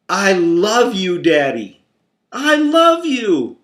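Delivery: slow, loud, high-pitched wheedling; butter up daddy before asking; child sweet-talking dad. Retold or imitated. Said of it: "crawled up on my lap and said".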